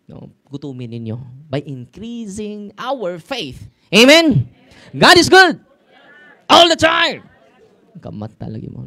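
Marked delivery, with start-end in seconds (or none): 1.5-3.7 slow, drawn out, every word leaned on; slow emphatic preaching. Acted. Preacher rallying the listeners. 3.9-7.3 loud, high preacher's shout; shouted call to congregation. Real, not acted. Call and response with listeners.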